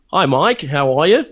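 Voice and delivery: Australian hello, rising at the end; Australian accent. Retold or imitated. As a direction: greet him in a put-on Australian accent. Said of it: "your Australian accent's spot on too".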